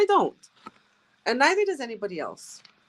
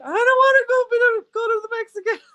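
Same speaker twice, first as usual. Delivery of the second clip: put-on high, loud whining little boy; whiny child; 13 semitones higher, 8 dB louder than usual. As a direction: whine like a frightened kid.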